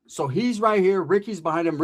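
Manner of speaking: ordinary talk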